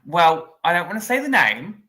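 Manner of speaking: usual speaking voice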